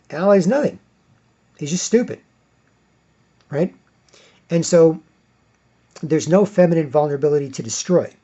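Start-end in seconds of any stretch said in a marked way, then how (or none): none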